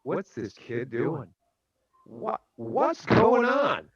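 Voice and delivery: mildly baffled, pitch leaping high at the end; drawn-out amazed puzzlement. Retold or imitated. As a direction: imitate a slow, wondering onlooker. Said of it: "watched with amazement".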